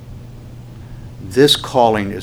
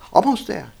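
high, sing-song encouragement; karate teacher; 10 semitones higher than usual. Retold or imitated. Instructor pushing a student down to the floor, high and coaxing. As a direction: cheer him on in a high voice. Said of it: "he goes".

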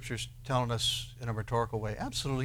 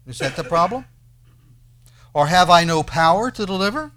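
raised, insistent rhetorical questions; indignant questioning; 4 semitones higher, 7 dB louder than usual. Acted. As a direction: challenge them as an indignant God. Reading God's indignant questions aloud.

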